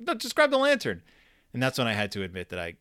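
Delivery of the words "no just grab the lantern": high, raised dismissive reply; impatient dad. Retold or imitated. Dad refuses and tells son to grab it himself, high impatient reply. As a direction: brush off the request.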